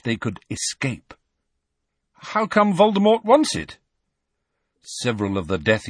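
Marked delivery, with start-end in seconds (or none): 2.1-3.8 high loud cheeky challenge; provoking boy. A narrator's reading. Harry provoking the Death Eaters, high loud cheeky. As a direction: taunt them with the name.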